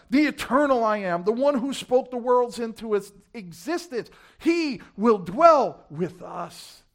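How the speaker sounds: high, loud, rolling proclamation; full-force preaching. Real, not acted. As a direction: pile up God's titles with rising force.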